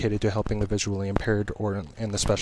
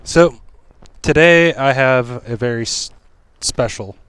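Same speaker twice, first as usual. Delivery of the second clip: shouting over wind; 5 dB louder, slower than usual. Real, not acted. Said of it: "hear me over the wind".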